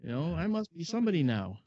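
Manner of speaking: mock-smug inner monologue; proud inner voice. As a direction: swell up with pride. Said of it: "and I thought".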